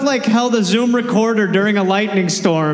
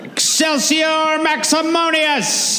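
mock wizard incantation; 10 semitones higher, slower than usual. Mock Latin spell.

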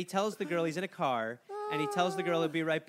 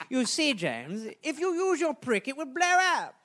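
higher, louder prim gadget-master voice; Q impression; 9 semitones higher, 5 dB louder than usual. Gadget maker briefing James Bond, a hammed-up lecturing impression. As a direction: play the fussy gadget man explaining to Bond. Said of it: "Much like a gadget that Q would give him".

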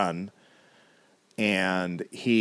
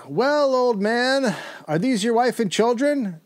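mocking slave buyer; 5 dB louder than usual. Retelling the buyer taunting Thomas, high sneering mock voice. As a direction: draw out old man like a smug buyer taunting.

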